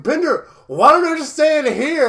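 high, loud mock excitement; hammed-up thrilled cartoon exclamation; excited imitation. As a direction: burst out with an eager, high-pitched plea. Acting out Fry's delighted request.

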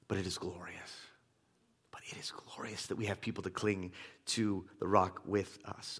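very soft, low, near-whispered preaching; sudden reverent hush. Real, not acted. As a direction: drop to a hush after the climax. Sudden hush after the shouted climax.

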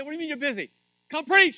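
high, loud mock outrage; exasperated demand. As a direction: insist again at a shout. Retells insisting after brother refused.

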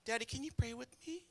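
put-on soft, small child's plea; little kid. Little kid asking Daddy to pray, small and soft. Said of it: "your little kids look at you like".

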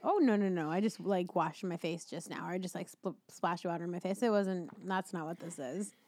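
low, soft, dismissive denial; quietly explaining it away; hushed brush-off. Pretend. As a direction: play down the excuse, voice dropped. Excuse after crying, acted out.

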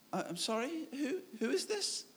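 soft, high, hesitant questioning; mock bewilderment, hushed and rising; puzzled phone caller. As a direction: mimic a confused woman, lifting into the question. Retold puzzled woman on phone.

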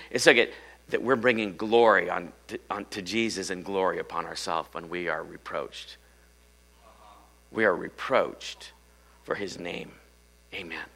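normal talking voice

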